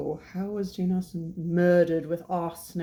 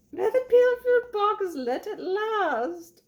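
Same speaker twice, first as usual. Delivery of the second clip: very high, drawn-out squeal of an English mother; hammed-up film mum, slow and piercingly high; Brenda Blethyn; 4 dB louder, slower than usual. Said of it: "I loved that actress that played the mom".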